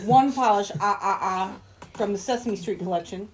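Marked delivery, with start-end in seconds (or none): 0.0-1.6 lightly voiced vampire count; a hint of a Transylvanian count counting. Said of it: "It's the Count".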